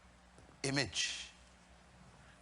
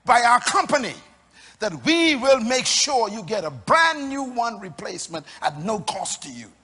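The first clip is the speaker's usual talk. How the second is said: loud preaching; 3 semitones higher, 9 dB louder than usual. Acted. Preacher voicing company promise.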